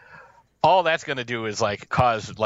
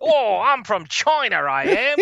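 hammed-up high Cockney, much higher than his own voice; mock Cockney accent; 9 semitones higher, 4 dB louder than usual. Mocking the dubbed villagers' Cockney to his co-host, hammed-up Cockney. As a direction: lay on a silly movie-dub Cockney accent. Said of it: "goddamn Cockney accents".

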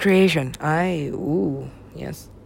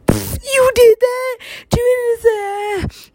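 high, slow, drawn-out shriek; mock outraged viewers; much slower than usual. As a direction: cry out in exaggerated disbelief, high. Acting out outraged viewers' reaction.

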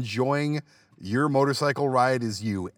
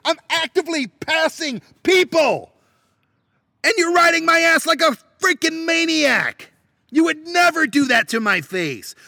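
high, loud angry rant; angry shouting; 10 semitones higher, 4 dB louder than usual. Real, not acted. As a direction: shout it at the tailgater. Ranting at tailgaters.

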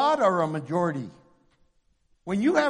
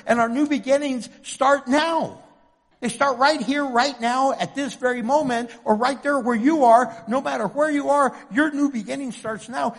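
rising fervent preaching; 4 semitones higher, 6 dB louder than usual. Acted. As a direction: preach it with building fire. Preaching at full force.

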